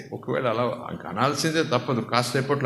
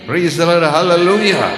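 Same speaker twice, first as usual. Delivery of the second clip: much louder, higher cry of praise; preaching shout; 6 semitones higher, 10 dB louder, much slower than usual. Real, not acted. Preacher rousing the congregation, loud praise shout. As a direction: call it out to the congregation at full force.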